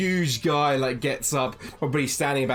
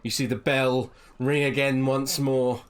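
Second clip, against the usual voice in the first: ordinary talk, nothing marked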